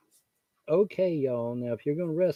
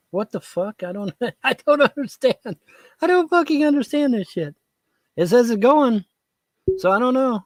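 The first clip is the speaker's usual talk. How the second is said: loud, higher frustrated outburst; exasperated; 11 semitones higher, 6 dB louder than usual. Real, not acted. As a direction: yell in disbelief at the screen. Stream fails, exasperated.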